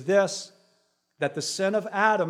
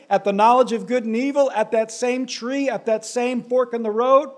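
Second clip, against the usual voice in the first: louder and higher, each phrase rising; full-force preaching; 6 semitones higher, 7 dB louder than usual. Acted. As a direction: build the sermon to a peak.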